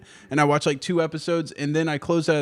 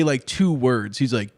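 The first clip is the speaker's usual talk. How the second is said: ordinary talk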